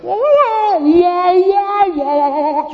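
very loud, high sustained whoas; hammed-up wailing entrance noise; mock grand whoa. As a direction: belt out long, high whoas. Imitates grand entrance in big gear.

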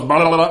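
low, rolling mock stomach gurgle; stomach rumble imitation. Preacher mimics someone's stomach during prayer, low gurgle. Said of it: "someone's stomach goes".

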